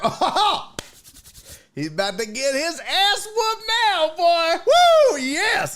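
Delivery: gleeful excitement. Retold or imitated. Narrator reacts to the drunk touching Keith's hair, laughing and whooping. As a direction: cheer like a fight is about to start.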